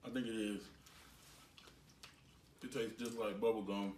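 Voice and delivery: chewing while talking; talking around chewy candy; mouth full of candy. Real, not acted. Seen 1: Both tasters chew candy throughout; unclear which one speaks.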